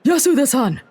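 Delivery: loud, high surprised cry; exclamation. A narrator's reading. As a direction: cry out the name in shock. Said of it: "the exclamation".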